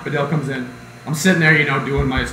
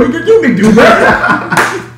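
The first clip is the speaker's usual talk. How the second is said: slow, loud, high mock taunt; drawn out, right in his face; 13 semitones higher, 8 dB louder, much slower than usual. Student taunting teacher up close, slow mock. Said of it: "He'd go".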